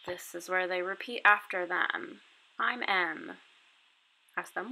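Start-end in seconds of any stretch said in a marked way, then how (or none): none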